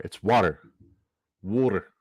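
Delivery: "water" with a rounded vowel, New York style; mock a New Yorker saying "water". Host imitating the New York "water". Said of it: "They go water".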